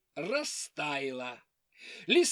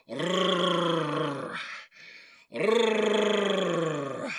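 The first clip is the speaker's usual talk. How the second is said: bear growl; 2 semitones lower than usual. Acted. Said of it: "закричал страшным голосом".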